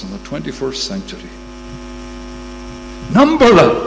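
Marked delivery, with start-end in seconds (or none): 3.1-3.8 high, loud command; preacher echoes God's command to the congregation, ringing; ringing challenge. Acted. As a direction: throw down the challenge.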